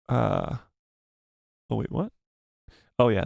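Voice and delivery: usual speaking voice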